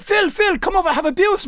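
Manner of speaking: high-pitched German brewmaster calling out. Retold or imitated. Brewmaster calling Phil over for a beer, imitated in high voice. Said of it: "the German brewmaster was sitting".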